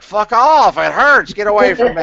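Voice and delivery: angry yell. Retold or imitated. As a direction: imitate the dying man yelling at his helper to back off. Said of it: "He's like".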